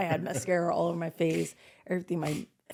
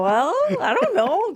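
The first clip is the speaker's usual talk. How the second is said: mock shock; 13 semitones higher, 8 dB louder, slower than usual. Acting her shock at a cold sore.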